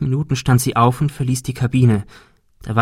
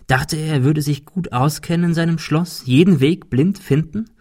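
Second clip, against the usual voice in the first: usual speaking voice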